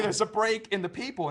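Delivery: ordinary talk, nothing marked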